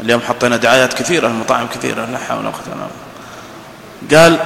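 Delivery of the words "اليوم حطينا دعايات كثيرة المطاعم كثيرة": normal talking voice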